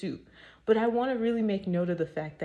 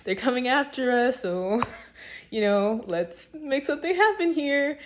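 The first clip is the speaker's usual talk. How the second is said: frantic, higher and louder pleading as imagined Moses; panicked Moses; 5 semitones higher, 5 dB louder than usual. Retold or imitated. Imagined Moses cries out to God as Egyptians chase them, frantic and loud. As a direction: cry out to God in mock panic as the army closes in. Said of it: "he's probably like".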